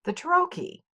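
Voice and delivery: high, set-apart heading; name the new foot like a title; announced title. Shown as a demonstration. Teacher to viewers, announcing the trochee in a high title voice.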